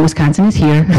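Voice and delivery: normal talking voice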